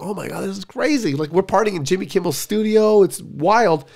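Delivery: high, giddy party gushing; wide-eyed, high-pitched amazement, retold; retold excitement. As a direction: relive being thrilled at the party. Retold thrill at free party.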